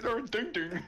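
laughing while calling out a made-up place; giggling through a callout. Retold or imitated. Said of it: "we were always like".

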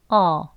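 어 said with jaw dropped, mouth open; 어 sound. Shown as a demonstration. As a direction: drop the jaw and say 어. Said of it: "소리는 어라고 내면". (턱을 떨구고 어)

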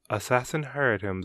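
plain reading voice

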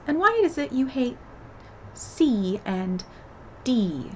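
ordinary delivery

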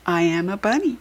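ordinary delivery

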